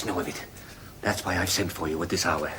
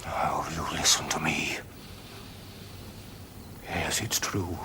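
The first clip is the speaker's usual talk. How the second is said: whisper; 4 semitones lower, 4 dB quieter than usual. Acted. Father answers son's accusation.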